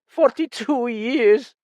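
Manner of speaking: high, slow, still restrained; voice rising. Acted. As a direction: start the rise, held back. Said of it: "his voice rising".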